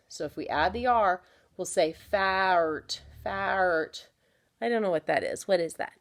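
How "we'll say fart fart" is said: fart said slowly with a clear R; slow demo word. Shown as a demonstration. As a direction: stretch the ar in fart. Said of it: "Listen carefully".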